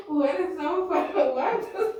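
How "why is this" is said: laughing through the words; giggling. Real, not acted. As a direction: giggle helplessly. Giggling fit.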